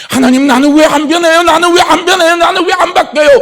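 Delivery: high, loud, near-sobbing cry to God; wailing prayer. Retold or imitated. Preacher voicing a believer crying out to God, high wailing plea. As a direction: wail it out like a desperate prayer. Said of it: "하나님 앞에 엉엉 우는 거예요".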